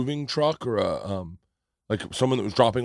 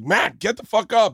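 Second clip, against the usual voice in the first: higher, louder retold yell at the dog; 10 semitones higher, 7 dB louder than usual. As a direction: bark the order to wake the dog. Yelling at the sleeping dog.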